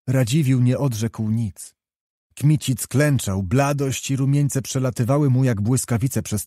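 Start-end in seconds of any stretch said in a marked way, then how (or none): none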